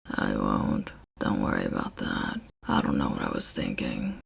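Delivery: very low, soft grumble; Shota's deep quiet voice. A narrator's reading. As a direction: mumble it, a little ashamed.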